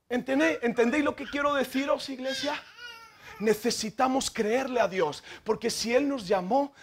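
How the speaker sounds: ordinary talk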